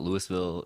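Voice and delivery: Louisville with the S sounded, "LOO-is-vil"; wrong pronunciation. Shown as a demonstration. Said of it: "It's not Louisville".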